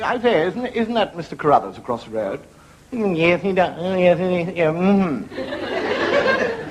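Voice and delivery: put-on high-pitched gossipy old ladies; gossiping ladies. Two women gossiping about a neighbour, in high prim voices. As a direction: ham up two nosy women chatting. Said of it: "the two women talking to one another".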